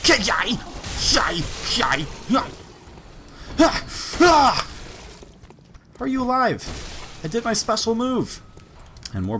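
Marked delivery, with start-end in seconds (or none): 0.1-4.7 high, loud yelps ending in shouted "ah"; battle cries. Real, not acted. Player attacking in a flurry, battle cries. 6.0-8.4 high-pitched incredulous protest; indignant disbelief. Real, not acted. Player to enemy that survived special move, incredulous.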